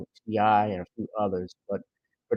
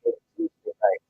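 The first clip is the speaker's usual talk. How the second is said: announcer; 7 dB louder than usual. Acted. Show opening announcer.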